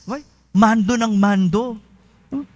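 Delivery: usual speaking voice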